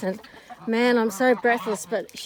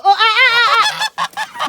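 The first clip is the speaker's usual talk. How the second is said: startled yelp; 12 semitones higher, 8 dB louder than usual. Real, not acted. Startled by flapping birds.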